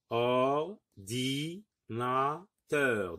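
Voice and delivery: "Ordinateur" very slowly, or, di, na, teur; syllable by syllable. Shown as a demonstration. Teacher locating the i, "Ordinateur" in very slow syllables. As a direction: draw out and separate all four syllables of "Ordinateur". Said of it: "essayons de localiser le son i".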